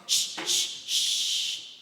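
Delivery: three pushed hissed shh. Shown as a demonstration. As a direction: squeeze out three last pushes. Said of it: "push three more times".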